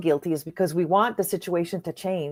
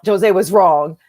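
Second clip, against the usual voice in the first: louder, triumphant self-declaration; emphatic; 8 dB louder, slower than usual. Retold or imitated. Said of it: "she said to herself".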